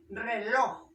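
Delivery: Reloj said loud, weight on final LOJ; stress on LOJ. Shown as a demonstration. Teacher giving an example, Reloj stressed on LOJ. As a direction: hit the last syllable LOJ in Reloj. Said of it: "la fuerza de voz en loj".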